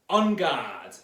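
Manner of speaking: loud drawn-out call to arms; battle cry. Shown as a demonstration. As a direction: call out the challenge before a sword fight. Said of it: "everybody say".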